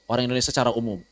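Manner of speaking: ordinary talk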